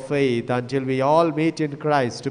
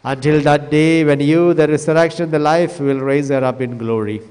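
usual speaking voice

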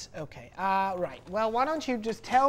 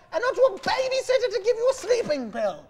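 laughing while scolding; 11 semitones higher, 7 dB louder than usual. Acted. Therapist laughs at the sleepless patient while scolding. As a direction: laugh through the insult, voice climbing high.

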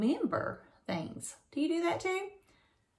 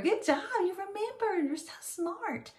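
warm praise; 10 semitones higher, 3 dB quieter than usual. Real, not acted. Praising a child's answer.

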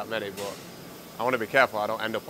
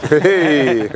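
loud, raised "hey"; cheering shout; 4 semitones higher, 14 dB louder than usual. Real, not acted. Friend at the noodle meal cheers, loud shout. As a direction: call out a big hearty hey.

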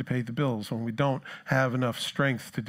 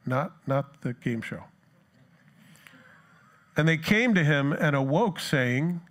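ordinary delivery

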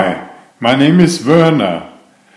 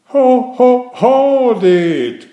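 cry out; 11 semitones higher, much slower than usual. Acted. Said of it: "cried the elf".

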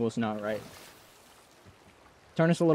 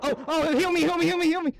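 desperate pleading; 11 semitones higher, 4 dB louder than usual. Real, not acted. Begging for healing mid-fight.

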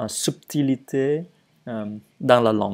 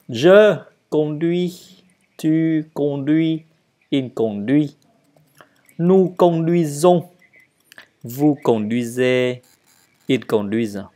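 model conjugation; 3 semitones higher than usual. Shown as a demonstration. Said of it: "à lire et à le dire".

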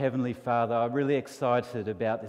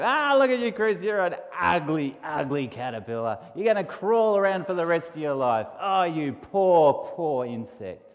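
high, loud sneering bug voice; mock him with fake pity; mean beetle; 3 semitones higher, 5 dB louder than usual. Beetle jeering at Chris, high and sneering. Beetle mocks caterpillar again.

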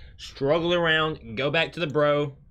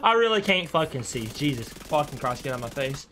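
normal talking voice

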